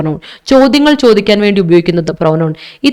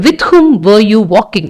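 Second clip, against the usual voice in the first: slow model question; much slower than usual. Shown as a demonstration. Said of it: "teacher models question slowly".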